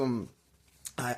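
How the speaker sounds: ordinary delivery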